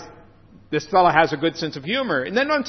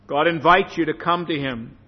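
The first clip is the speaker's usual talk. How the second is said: ordinary talk, nothing marked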